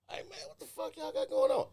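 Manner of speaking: very high, incredulous squeal; comic high-voiced bewilderment; mock alarm. Acted reaction to sour faces.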